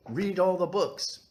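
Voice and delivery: high, slow, hammed-up plea; exaggerated enthusiasm. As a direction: stretch it out big and excited.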